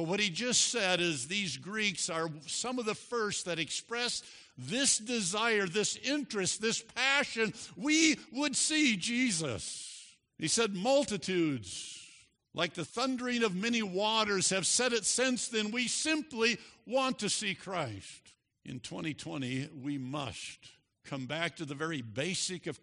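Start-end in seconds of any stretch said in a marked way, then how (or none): 4.6-10.0 raised, loud, building fervor; impassioned preaching. Real, not acted. Preacher voicing the Greeks' passion. 10.7-18.3 high, driving pulpit voice; fervent, raised delivery; impassioned preaching. Real, not acted. Preacher retelling the quote with fervor.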